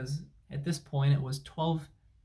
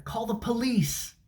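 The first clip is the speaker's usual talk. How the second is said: high, slow, put-on mocking voice; mock agent voice; 8 semitones higher than usual. Mocking the support agent's advice, in a high, slow put-on voice. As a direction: stretch it out high in a mock foreign voice.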